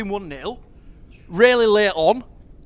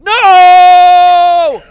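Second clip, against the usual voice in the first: shout; 7 semitones higher, 15 dB louder than usual. Real, not acted. Reacting to goal against.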